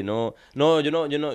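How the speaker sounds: ordinary talk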